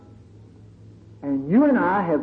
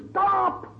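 shrill screamed cry, much higher; screamed stop; 13 semitones higher than usual. Retold or imitated. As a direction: shriek it at the sky in defiance. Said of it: "shook their fist at the sky and screamed".

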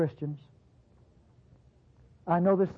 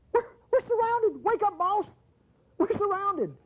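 much higher, frantic servant waking his master; panicked servant; 12 semitones higher than usual. Acted. Elijah's servant wakes his master, much higher and panicked. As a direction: servant crying out in alarm. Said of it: "Elijah's servant there at Dothan".

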